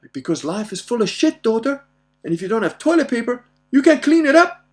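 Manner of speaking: worked-up dad imparting wisdom; emphatic fatherly lecture. Retold or imitated. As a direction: drive home a comic life lesson at rising volume. Dad's mock lesson building to punchline.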